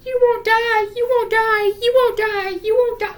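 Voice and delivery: high, loud repeated chant; mock sister voice. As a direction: squeal it high and loud, over and over. Acting out the sister's words.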